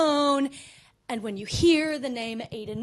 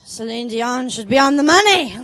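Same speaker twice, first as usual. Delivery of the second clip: patriotic dream outburst, louder toward the end; mock sleep-talking; 7 dB louder than usual. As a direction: blurt it out as if asleep, building to a cry. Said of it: "wildly patriotic in my sleep".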